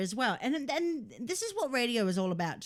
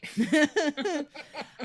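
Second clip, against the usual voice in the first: high, loud belly laugh; loud laughter; 7 semitones higher, 6 dB louder than usual. Real, not acted. Laughing with cohost.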